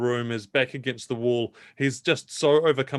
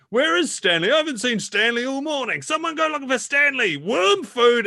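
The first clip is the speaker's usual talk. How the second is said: much higher, worried and calling; a brother calling out; 13 semitones higher, 4 dB louder than usual. Acted. A brother searching for Stanley, high and anxious. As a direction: call around looking for Stanley. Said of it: "you can hear another voice".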